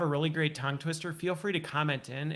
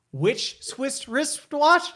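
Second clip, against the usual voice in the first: very slow, higher and a bit louder, each word spaced; slow careful tongue twister; 9 semitones higher, 5 dB louder, much slower than usual. Shown as a demonstration. Host slowly demonstrating a tongue twister, spaced words. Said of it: "I'll see if I can even do it".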